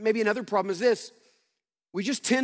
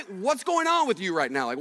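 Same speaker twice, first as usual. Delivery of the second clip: ordinary delivery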